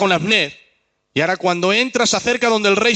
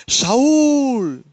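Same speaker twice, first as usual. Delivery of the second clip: shouting a name; 6 semitones higher, 3 dB louder than usual. Acted. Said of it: "empieza a gritar".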